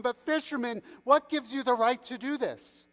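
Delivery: high, agitated protest; frightened. A narrator's reading. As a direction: challenge him as composure slips. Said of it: "just another frightened man".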